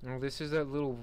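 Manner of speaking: normal talking voice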